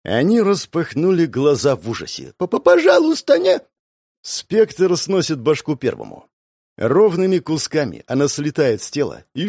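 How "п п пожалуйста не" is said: high stammering shout for mercy; terrified begging. Acted. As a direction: plead in panic. Victim begs before death.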